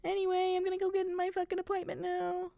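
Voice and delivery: high, strained fake-casual voice; put-on breezy cover for nerves; trying to be normal. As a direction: force a fake laugh and excuse yourself in a high voice. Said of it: "I'm trying to be normal".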